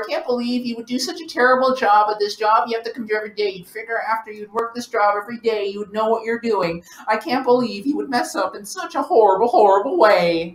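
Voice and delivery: high voice, fast indignant tirade; high-pitched Karen rant. Acted. Angry customer berating the clerk, high indignant rant.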